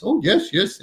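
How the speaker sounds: put-on light Hindi accent, pitched high and lilting; a gentle imitation of Swamiji's Hindi accent. Teacher imitating Swamiji greeting him before the community, light Hindi accent.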